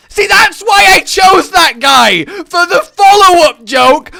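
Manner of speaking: loud, high outburst; exasperated shout. Real, not acted. Streamer caught out by the joke, shouting. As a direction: burst out yelling at your own failure. Exasperated at own failed impression.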